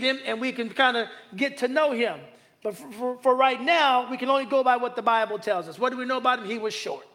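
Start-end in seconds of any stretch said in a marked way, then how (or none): none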